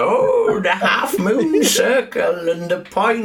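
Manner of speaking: high-pitched mock old man; old man impression. Podcaster mimicking the old man on screen, comic high old voice. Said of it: "That is pretty much what he's going for here".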